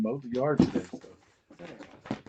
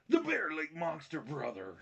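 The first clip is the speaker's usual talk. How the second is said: put-on wrestler voice, high start falling away; mock wrestling-promo announcement; Hulk Hogan; 4 semitones higher, 5 dB quieter than usual. Dad announcing fake breaking news, hammed Hulk Hogan voice. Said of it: "My Hulk Hogan voice".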